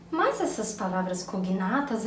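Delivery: usual speaking voice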